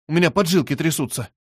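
ordinary delivery, nothing marked

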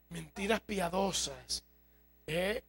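ordinary talk

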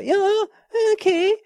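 sudden high squeak on okej; squeaky voice check. Shown as a demonstration. Checking his voice at the till, pitch cracks high. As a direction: test the voice, it pops up squeaky. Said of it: "min röst kommer att vara aspipig".

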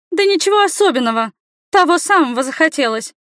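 put-on high-pitched girlish retort; little girl standing her ground; defiant girl. A narrator's reading. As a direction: answer back high and feisty. Girl taunts, standing up like fighting sparrow.

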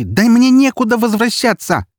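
high, loud enraged scream; furious yell. A narrator's reading. Эри screams at the narrator in the corridor, furious. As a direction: yell it down the whole corridor in a rage. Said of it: "в бешенстве заорала она на весь коридор".

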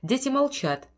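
usual speaking voice

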